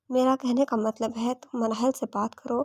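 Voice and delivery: ordinary delivery, nothing marked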